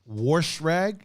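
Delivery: "Wash rag" as "warsh rag", rising in question; echoed regional pronunciation. Retold or imitated. Streamer echoing a friend's grandfather, puzzled regional pronunciation. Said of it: "my grandfather used to say wash rag".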